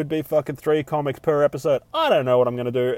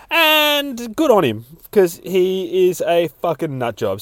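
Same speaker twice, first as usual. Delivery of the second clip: gleeful cheer; 6 semitones higher, 5 dB louder than usual. Real, not acted. Cheers on the redneck.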